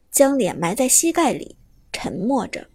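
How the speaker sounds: plain reading voice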